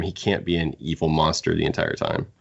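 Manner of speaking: usual speaking voice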